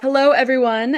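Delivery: usual speaking voice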